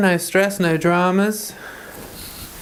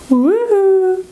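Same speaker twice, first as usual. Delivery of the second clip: high, loud whoop of delight; bright, loud woohoo at the pet; excited whoop; 5 dB louder than usual. Real, not acted. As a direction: cheer the dog on like she's a star. Cheering the dog on camera.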